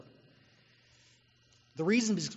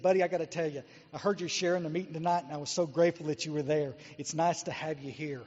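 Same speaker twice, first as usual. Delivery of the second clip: put-on soft, tender old man's words, dropping to a hush; tender quiet. Old sober man to young newcomer, softly and tenderly. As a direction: gently tell a newcomer he is needed. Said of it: "And he said".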